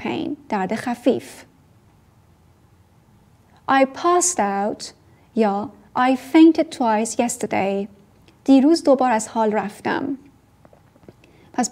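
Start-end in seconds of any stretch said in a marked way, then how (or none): 3.7-7.9 slow model sentence. Shown as a demonstration. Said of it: "model sentence".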